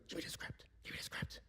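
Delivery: sharp, hissed stage whisper; urgent whisper. Retold or imitated. Father whacking Uncle Frank, whispering urgently. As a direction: whisper an order through the teeth.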